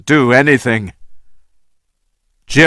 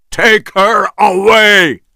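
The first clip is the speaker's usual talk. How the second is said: higher shouted order; commanding shout; 8 semitones higher than usual. A narrator's reading. Engelhorn ordering Jack, shouted. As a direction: order her removed.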